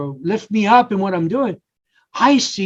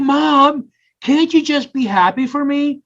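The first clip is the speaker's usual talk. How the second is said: pleading with mom in a higher voice; 7 semitones higher than usual. Retold or imitated. Son pleading with his dismissive mom, higher and imploring. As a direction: ask her to just be glad for you.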